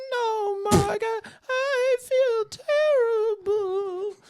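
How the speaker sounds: very high, slow wailing ghost voice; ghost mother. Acted. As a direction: moan like a pitiful ghost mom.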